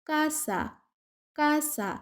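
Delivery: কাসা said with a hard k, like Bengali ক, carefully modelled; Spanish word casa. Shown as a demonstration. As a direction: model কাসা slowly with a crisp k sound. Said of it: "সে এর উচ্চারণ হচ্ছে ক এর মতো".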